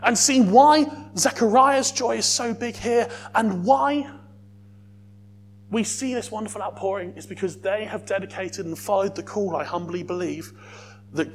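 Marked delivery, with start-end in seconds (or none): 0.0-7.8 louder, building fervent preaching; full-force preaching. Acted. Preacher urging the church toward worship, loud fervent preaching. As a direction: drive the joy home to the congregation.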